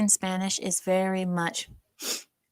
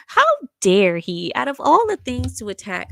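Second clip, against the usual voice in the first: ordinary talk, nothing marked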